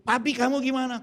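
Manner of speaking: high-pitched worried-wife imitation; wife's voice. Wife asking husband about himself, high imitated voice. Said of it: "istri saya bilang gini".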